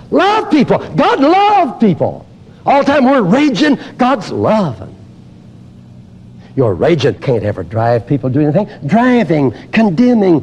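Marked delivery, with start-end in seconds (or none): 0.1-4.9 high, ringing plea to love; fervent preaching. Acted. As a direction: lift the voice in appeal.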